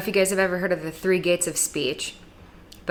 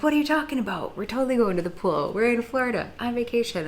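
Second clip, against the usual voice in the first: incredulous visitors; 5 semitones higher, faster than usual. Retold or imitated. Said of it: "And they're like".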